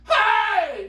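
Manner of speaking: high, loud shouted protest; re-enacted outcry. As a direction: yell it out in mock outrage. Re-enacts reaction to the cover.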